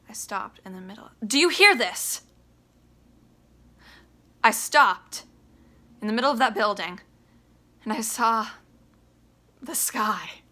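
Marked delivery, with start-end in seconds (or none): 1.3-8.5 raised, pleading, furious demand; angry outburst. Acted. Biff erupts at his father. 9.6-10.4 awed hush on the sky; whisper. Acted. Biff's moment of realization.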